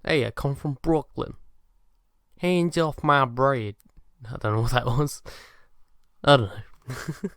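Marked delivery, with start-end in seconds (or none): 0.0-3.8 put-on Brooklyn accent, slowing down; rough attempt at a louder Brooklyn accent. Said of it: "If you could fake an accent".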